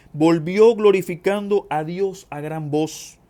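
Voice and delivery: declamatory reading, swelling on key phrases; scripture reading. Acted. Reads scripture aloud to congregation.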